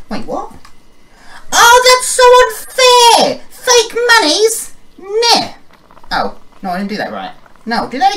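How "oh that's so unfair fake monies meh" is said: high, slow whining; whiny man child. Pretend. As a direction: whine like a spoiled kid. Complaining about fake money.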